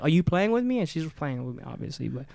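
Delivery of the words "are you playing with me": high, incredulous question; high disbelief. Retold or imitated. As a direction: ask in shock. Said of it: "And I'm like".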